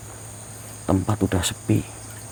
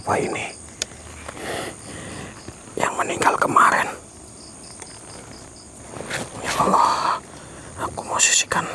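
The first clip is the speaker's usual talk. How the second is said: breathless low whisper near the spirit; hushed whisper; 6 dB quieter than usual. Real, not acted. Vlogger whispers to viewers about the ghost, hushed. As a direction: whisper it, scared of being heard.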